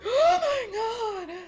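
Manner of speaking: high, slow, laughing "oh my god"; hammed-up horror with a laugh; mock shocked gasp. Officials hearing the tunnel collapsed, mock shocked gasp. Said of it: "And they're like".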